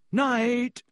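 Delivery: high-pitched call over the shoulder; calling back. A narrator's reading. Boy says goodnight to a scowling friend, calling back. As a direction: sing out a quick goodnight. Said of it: "Harry called back to Hermione".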